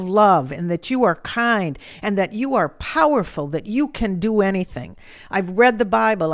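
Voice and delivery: loud, indignant accusation; angry retold tirade, forcefully stressed; yelling at God. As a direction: hurl each charge at God at full volume. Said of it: "I was yelling at the top of my lungs".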